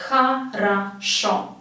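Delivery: "Хорошо" slowly as kha, ra, SHO; syllable by syllable. Shown as a demonstration. Teacher demonstrating the rule, "Хорошо" split into kha-ra-SHO. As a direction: separate the syllables of "Хорошо", A, A, then O. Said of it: "they will be pronounced as A".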